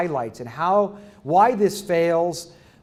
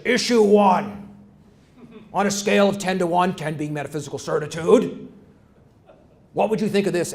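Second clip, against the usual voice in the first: McLaughlin impression. Lecturer mimicking the TV host posing his question, lightly voiced. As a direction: lightly mimic a TV panel host announcing the issue. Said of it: "he talks like this".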